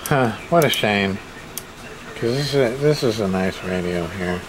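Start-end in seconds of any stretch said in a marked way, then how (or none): none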